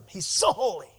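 usual speaking voice

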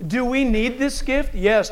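ordinary talk

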